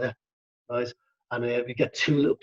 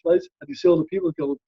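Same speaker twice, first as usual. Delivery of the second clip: ordinary talk, nothing marked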